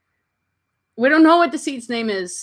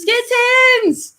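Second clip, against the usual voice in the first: much higher, bright excited squeal; delighted squeal; 14 semitones higher, 3 dB louder than usual. Real, not acted. Owner greeting the kittens brought in, excited squeal.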